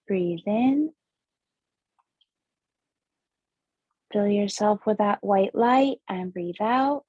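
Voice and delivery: slow meditation guide, long pauses between breaths; guided breathing meditation. Acted. Host guides listeners through seven breaths, measured meditation voice.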